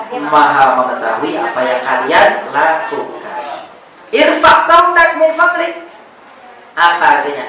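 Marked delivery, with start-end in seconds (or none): 4.1-5.9 high and loud, the phrase called out; raised voice. Shown as a demonstration. Teacher calling the phrase out to the class, high and loud. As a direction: call it out high and loud, acting the meaning. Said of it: "tinggikan, keraskan suaramu".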